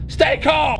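high, urgent shout; called-out reassurance. Real, not acted. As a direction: yell it across to someone panicking. Calling out to crying girl.

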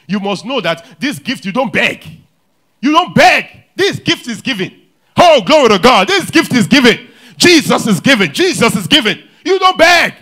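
loud, high preaching climax; exultant sermon shout. Acted. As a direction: proclaim it with full force. Preaching at full force.

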